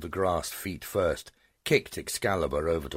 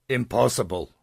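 indignant outburst; 8 semitones higher, 7 dB louder than usual. Acted. Dragon mother refuses to believe.

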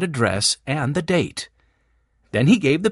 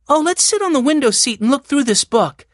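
put-on high, louder girl character; eager Jessie; 11 semitones higher, 5 dB louder than usual. A narrator's reading. Jessie invites the others to look through the book, eagerly. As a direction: suggest it in a high, lively girl's voice.

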